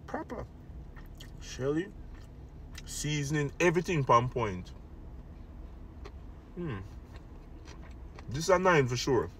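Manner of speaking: eating between words; talks while chewing. Real, not acted. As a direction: mouth partly full of food. Seen 2: Eating on camera throughout, lips closed and chewing between phrases.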